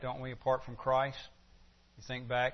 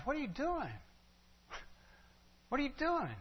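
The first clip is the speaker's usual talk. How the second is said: put-on high, softer questioning of a child; parent to child; 12 semitones higher, 7 dB quieter than usual. Parent questioning their kid, high and soft. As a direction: ask like a parent catching a kid out. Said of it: "we do that to our kids".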